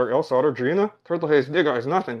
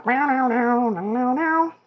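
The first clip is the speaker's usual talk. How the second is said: cat meows; 9 semitones higher, slower than usual. Pretend. Meowing for cat viewer.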